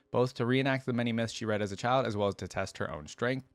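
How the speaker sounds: usual speaking voice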